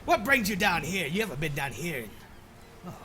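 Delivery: put-on loud, hearty old sailor; gruff old fisherman. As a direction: boom it out like an old seadog. Old sailor friend greets.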